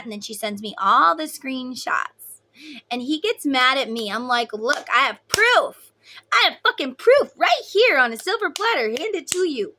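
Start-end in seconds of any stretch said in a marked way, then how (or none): 4.5-9.8 much higher and louder, a heated retold outburst; retold angry confrontation. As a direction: throw the proof at him, indignant and loud. Confronting cheating husband, retold.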